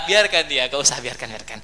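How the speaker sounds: low, softened acted reply; calm restraint. Prophet restrains the Ansar man, calm restraint. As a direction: drop low and soft to hold him back.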